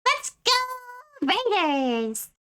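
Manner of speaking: high hyped raid call. Pretend. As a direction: pitch up and rally the raiders. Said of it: "can do this high voice too".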